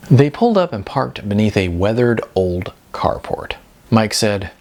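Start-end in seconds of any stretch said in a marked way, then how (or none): none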